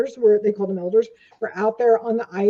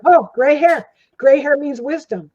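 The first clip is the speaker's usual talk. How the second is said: louder, higher, eager exclamation; excited call; 5 semitones higher, 7 dB louder than usual. Retold or imitated. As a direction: call out admiringly as he passes. Young men hail the grey-haired visitor.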